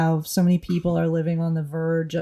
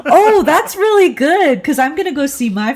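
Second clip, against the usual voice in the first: real amused delight; laughing delight; 9 semitones higher, 7 dB louder than usual. Real, not acted. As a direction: laugh out the compliment. Delighted by the accent idea.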